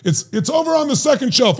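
high, mock-impatient parent. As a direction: point the kid to the shelf. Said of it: "parent directing kid".

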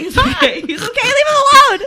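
high, laughing plea to stop. Real, not acted. Laughing at the panting dog.

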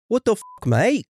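put-on over-the-top Australian accent. Co-host shows how the Aussie line should sound in a silly accent. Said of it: "It's supposed to be another shrimp on the barbie".

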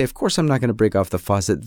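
quick, low, brushing-off reply; lightly mocking his own breezy promise; dismissive. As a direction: wave the warning away fast. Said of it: "I say".

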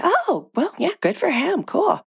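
high, breezy husband impression; airy, high, mock-casual voice; imitating husband. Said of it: "and he said".

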